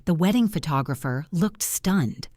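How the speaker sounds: ordinary delivery, nothing marked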